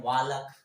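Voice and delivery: "वालक" with the l sounded; "वालक", silent l wrongly pronounced; common mispronunciation. Shown as a demonstration. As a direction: say "वालक" in two syllables, l audible. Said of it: "बहुत बच्चे लोग पढ़ते हैं".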